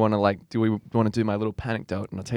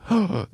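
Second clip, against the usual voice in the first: excited gasp; 7 semitones higher than usual. Retold or imitated. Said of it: "And I go".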